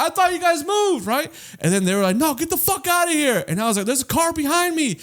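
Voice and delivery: high-pitched retold exchange; squeaky high voices. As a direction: keep it all way up high. Voicing self and others very high.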